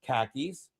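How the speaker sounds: low, soft deadpan khakis; straight-faced khakis. Retold or imitated. Retelling the ad guy's reply, low and deadpan. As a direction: say it dead straight, hushed and low. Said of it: "straight-faced guy going".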